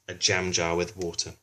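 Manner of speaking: ordinary delivery, nothing marked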